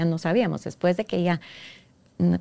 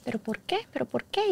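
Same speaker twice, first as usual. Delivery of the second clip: put-on insistent sweet child; 5 semitones higher, 6 dB quieter than usual. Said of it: "aquella insistencia, aquella dulzura".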